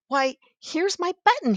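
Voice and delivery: high, loud delighted cry; excited cry. A narrator's reading. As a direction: shout with joy at finding it. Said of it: "he cried".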